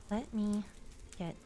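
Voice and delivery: hushed, slow whisper while working; soft unvoiced murmur under your breath. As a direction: breathe the words out as you concentrate. Whispering during delicate surgery.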